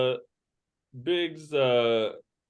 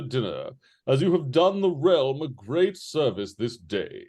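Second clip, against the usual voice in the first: higher-pitched, formal British letter reading; British accent; 4 semitones higher, 4 dB louder than usual. Acted. Game master reads a noble's dinner invitation, in a British accent. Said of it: "can you do a British accent".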